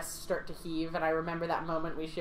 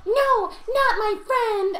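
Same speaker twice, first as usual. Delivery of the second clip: loud, very high, drawn-out anguished cry; yell; 7 dB louder, much slower than usual. Acted. Player character shouts to save her friend, high slow yell. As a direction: scream it slowly, high and desperate. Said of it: "I yell".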